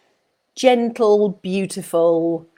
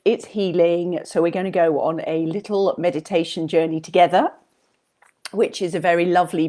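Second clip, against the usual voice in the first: ordinary talk, nothing marked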